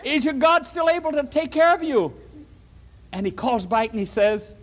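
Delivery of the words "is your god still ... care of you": high calling out to the pit; king calling down. Acted. King calls down into the den, high and eager. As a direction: call down into the den. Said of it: "shouts and said".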